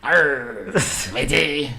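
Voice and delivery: lightly growled pirate arr. Pretend. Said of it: "That's a pirate".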